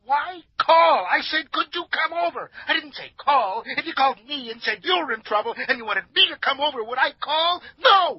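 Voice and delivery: very high, frantic, rapid-fire scolding; indignant outburst. Acted. Cleaner berates friend for phoning instead of coming, frantic indignation. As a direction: explode in hurt disbelief, building to a shouted NO.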